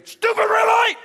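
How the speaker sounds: high furious yell at traffic; angry shout. Retold or imitated. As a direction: explode at the red light. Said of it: "loses his temper at a red light".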